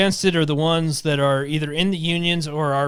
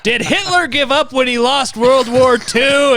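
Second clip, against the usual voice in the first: mock coach voice; 8 semitones higher, 5 dB louder than usual. Joins the mock pep speech.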